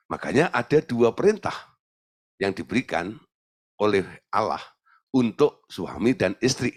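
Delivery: ordinary talk, nothing marked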